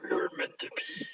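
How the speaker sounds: very low, soft monster voice; Venom impression. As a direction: drop it deep and quiet as Venom. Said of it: "that's not a bad Eddie".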